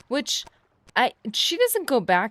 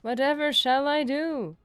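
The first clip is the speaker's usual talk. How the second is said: higher, slow, hammed-up helpless victim; mock damsel in distress; 6 semitones higher, slower than usual. Player mocking his absent companion while being attacked, hammed-up helpless whine. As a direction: overact the poor wounded hero for laughs.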